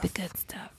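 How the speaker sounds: hushed repeat of the good stuff; whispered The good stuff; whispered echo. Pretend. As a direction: breathe it back in a whisper. Echoing friend's phrase in whisper.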